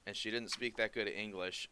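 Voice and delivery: normal talking voice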